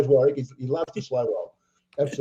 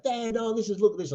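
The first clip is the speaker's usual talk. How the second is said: lightly imitated daughter, pitched higher; daughter's excited call; 9 semitones higher than usual. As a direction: retell a daughter's eager call to her dad in a higher voice. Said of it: "my daughter at home's going".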